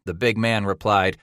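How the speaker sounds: usual speaking voice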